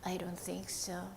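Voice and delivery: soft whispered puppet reply. Acted. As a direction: whisper it, shy and low. Puppet answers softly.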